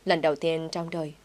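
ordinary delivery

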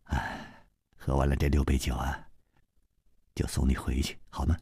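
very soft, low coaxing; sigh, then coax softly; hushed and tender, pitched low; gentle, tender voice. A narrator's reading. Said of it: "楚留香柔声说".

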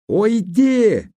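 very high, loud, piercing cry; frantic scream. A narrator's reading. Ruth screams frantically as Herbert lunges at her. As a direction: scream with everything she has. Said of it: "крикнула она истошным голосом".

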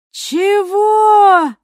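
long, mocking drawn-out "what"; drawn-out sneer. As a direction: stretch it out high and insolent. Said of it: "протянул он".

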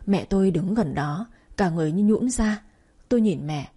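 ordinary delivery, nothing marked

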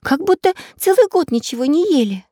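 put-on high, amused girl voice; amused little girl. A narrator's reading. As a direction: remark it high, tickled by the sight. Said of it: "засмеялась Настенька".

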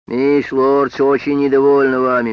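loud, cold reprimand; stern loud scolding. Acted. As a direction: scold him from above. Library policeman scolds Sam.

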